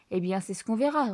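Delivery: ordinary delivery, nothing marked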